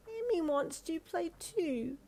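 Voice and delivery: put-on high, slow, tearful child voice; little girl on the edge of tears; quivering little girl. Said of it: "Emmy's voice quivered with emotion".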